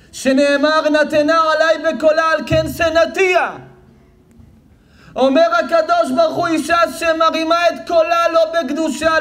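high, loud preacher's cry with slowed chanted verses; fiery preaching. Acted. Preaching at full force.

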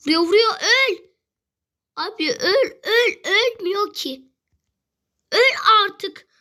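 urgent, high-pitched frustrated yelling; exasperated shouting. Real, not acted. Gamer shot at by a stubborn enemy, exasperated yelling. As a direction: yell at an enemy who refuses to die. Enemy shooting and refusing to die.

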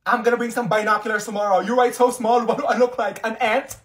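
loud, high sarcasm; teacher mocking a student; sarcastic teacher. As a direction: sneer at the student.

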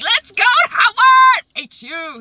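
high, loud, drawn-out cheer; excited shout. Acted. Randall leaving for college, an excited high shout. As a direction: bounce with glee. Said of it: "He's all excited".

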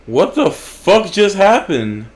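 a gamer raging at the screen, dragged-out yell; disbelieving yell. Real, not acted. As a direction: stretch every word in outraged disbelief. Rage at losing the fortify.

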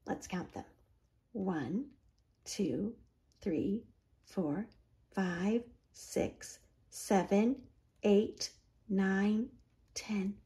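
soft, whispered counting; hushed counting. A narrator's reading. Reading to children, counting ducks in a soft hush. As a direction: count them gently under your breath.

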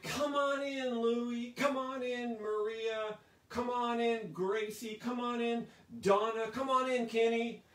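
high, loud calling-out of each name; jubilant welcome. Acted. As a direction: call them in excitedly from the door. Voicing a joyful welcome into heaven.

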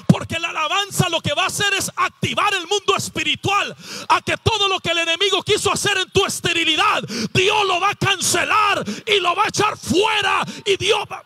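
impassioned declaration to the congregation; fervent preaching. Real, not acted. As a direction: preach it with rising force. Preaching at full force.

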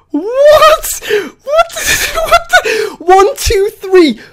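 loud, very high squealed excitement; hysterical disbelief. Real, not acted. Streamer got the shiny in five, hysterical disbelief. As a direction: squeal and count it out, losing control with joy. Counts tries, overwhelmed by shiny.

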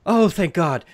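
high, loud cry of relief; shaken diplomat. Acted. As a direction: exclaim with desperate relief. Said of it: "diplomat says".

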